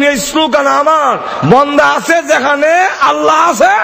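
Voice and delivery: high-pitched, ringing sermon call; preaching. Acted. Preacher asking congregation where Allah is, high call-and-response preaching. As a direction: preach high, lifting phrase ends for response.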